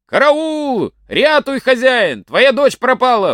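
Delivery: high, loud alarm cry; yelling at the top of his lungs. Acted. Lodger wakes the old man, shouting high in alarm. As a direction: raise the whole house with a panicked yell. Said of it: "закричит во всё горло".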